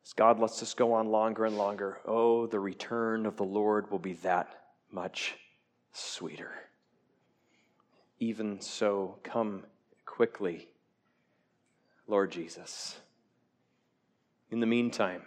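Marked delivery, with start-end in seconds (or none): 2.0-6.5 softer, fading to a whisper on sweeter; tender fading promise; hushed longing. Acted. Tender hope of Christ's return. 8.2-13.0 soft prayerful plea. Acted.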